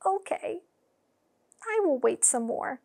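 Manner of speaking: plain reading voice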